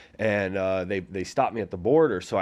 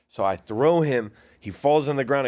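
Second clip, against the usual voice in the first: ordinary talk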